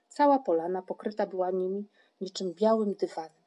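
ordinary delivery

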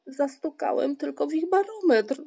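high, loud plaintive whine; complaining. A narrator's reading. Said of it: "żalił się Paszczak".

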